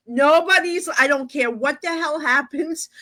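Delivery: fed-up declaration. Retold or imitated. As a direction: lay down the law in mock exasperation. Retold laying down the law.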